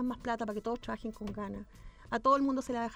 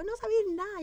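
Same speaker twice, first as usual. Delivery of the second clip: mock yell the good news to her friend; retold shout; 8 semitones higher than usual. A woman shouting the news to her friend at the bookstore, high excited yell. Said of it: "y yo grito".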